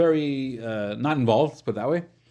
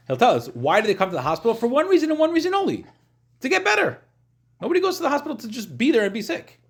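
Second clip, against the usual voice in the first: higher and louder, building fervor; passionate, rising emphasis; 12 semitones higher, 3 dB louder than usual. Real, not acted. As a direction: drive the point home with rising passion. Emphatic point about the hospital.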